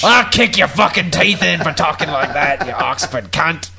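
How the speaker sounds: loud, hammed-up Cockney tough; exaggerated Cockney yob accent; mock Cockney threat. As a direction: pick a mock fight in Cockney. Mock Cockney threat at posh speech.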